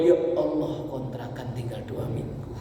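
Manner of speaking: breathless, voiceless plea; whispered prayer. Retold or imitated. Preacher imitates a worried tenant praying in a whisper. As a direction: murmur it privately to God.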